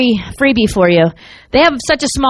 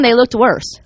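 ordinary delivery

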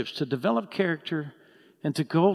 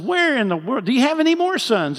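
higher and louder, rushing the second half; an impatient prophet demanding more sons; exasperated plea; 8 semitones higher, 6 dB louder than usual. Retold or imitated. Samuel asking Jesse for more sons, higher, louder, exasperated.

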